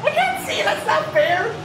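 high frantic yelling at the screen; frustrated shout. Real, not acted. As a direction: yell in frustration, pitch climbing.

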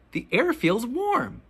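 ordinary delivery, nothing marked

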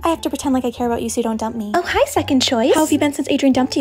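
ordinary delivery

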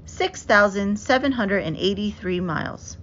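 plain reading voice

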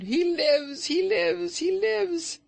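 very high, loud screaming joy; ecstatic scream. A narrator's reading. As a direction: scream it out in wild triumph. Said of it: "she screamed".

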